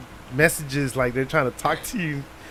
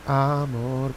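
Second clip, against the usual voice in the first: low voice; 4 semitones lower, 5 dB quieter than usual. Shown as a demonstration. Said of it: "in a low voice".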